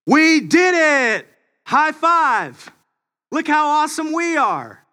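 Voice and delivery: high, hammed-up cheering; mock gloating soldiers. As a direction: ham up the silly victory whoop. Soldiers mock celebrating on hill.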